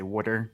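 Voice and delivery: "water" as a low "WOOD-er"; Philly "wooder". Shown as a demonstration. Podcaster proving he's from Philly, "wooder" for water. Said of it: "the way I just say".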